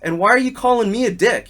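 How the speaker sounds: retold exasperated scolding; exasperated retort. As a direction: scold the rude coach, voice up high. Retold argument with other coach.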